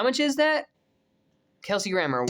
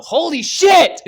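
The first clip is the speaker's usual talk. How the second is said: loud, higher outburst; excited exclamation; 11 semitones higher, 12 dB louder, slower than usual. Real, not acted. A fan reacting to Doctor Doom casting, excited outburst. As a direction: burst out in excitement.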